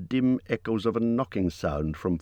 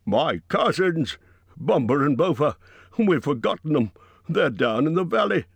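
higher, louder cry of sudden dismay; alarmed cry; 7 semitones higher, 3 dB louder than usual. A narrator's reading. Bifur crying out about his forgotten cousins, raised and distressed. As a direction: cry out as if remembering a terrible mistake. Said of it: "Bifur gave a cry".

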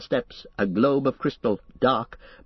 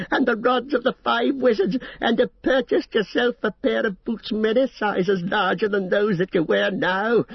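much higher, shrill furious scream; rising to a scream; 10 semitones higher than usual. Acted. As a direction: scream it in wild rage. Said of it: "his voice rose to a scream".

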